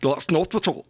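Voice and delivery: light mock Scottish accent. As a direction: tease a Scottish friend in a hint of Scottish accent. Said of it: "I always say this to Scottish people".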